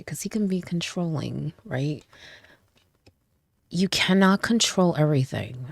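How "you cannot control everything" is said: normal talking voice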